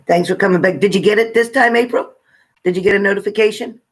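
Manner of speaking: ordinary talk, nothing marked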